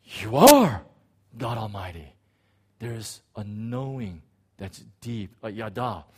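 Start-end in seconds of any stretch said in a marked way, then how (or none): 0.0-2.1 awed realization. Retold or imitated. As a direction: breathe it out in sudden wonder.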